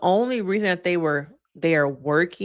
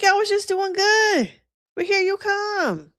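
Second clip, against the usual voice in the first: very high, squeaky complaint; hammed-up exasperation, high-pitched with a drawn-out finish; mock exasperation. Host scolds him for spoiling the moment, high mock exasperation. Acting out exasperated viewer reaction.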